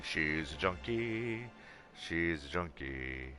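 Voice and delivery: slow, soft and dry; deadpan. Real, not acted. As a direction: say it flatly, slowly. Deadpan comment.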